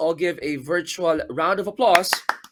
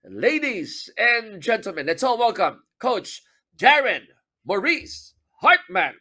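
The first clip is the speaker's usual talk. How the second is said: higher, slow showman welcome; announcer intro; 6 semitones higher than usual. Real, not acted. Host presenting the guest coach, announcer style. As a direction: build it up like a game-day announcer.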